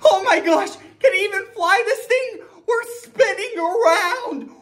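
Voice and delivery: high, fast, hammed-up panic; panicked passenger. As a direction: squeal in mock terror as the plane spins. Panicked passengers in dizzy plane.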